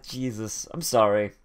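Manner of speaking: ordinary delivery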